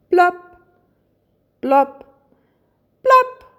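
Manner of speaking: short, popped plops, well spaced; dripping-tear plops. A narrator's reading. Rainbow's tears drip into paint pots, imitated plops. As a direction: imitate single drops, loud and pitched apart. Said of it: "tropfte eine Träne nach der anderen".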